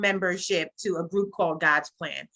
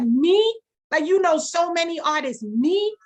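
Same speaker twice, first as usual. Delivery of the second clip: much higher and louder, thrilled disbelief; retelling her thrilled, stunned question; excited disbelief; 10 semitones higher, 6 dB louder than usual. Retold or imitated. Retold stunned reply to Josh.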